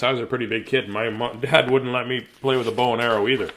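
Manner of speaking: usual speaking voice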